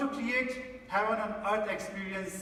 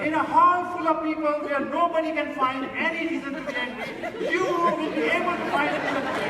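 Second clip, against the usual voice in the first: louder, higher, driving the point home to the hall; forceful preaching; 6 semitones higher, 7 dB louder than usual. Acted. Speaker warns the audience about practicing anger, preaching loudly.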